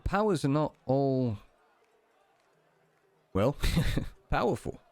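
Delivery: halting, lightly voiced Cockney; Cockney with a small chuckle midway; Cockney British accent. Deity answers the frustrated player in a light Cockney accent. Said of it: "kind of Cockney British accent".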